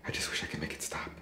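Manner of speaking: very soft, voiceless wish; whispered despair. Acted. Sleepless man wishing it would stop, whispering. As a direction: sink into a weary whisper.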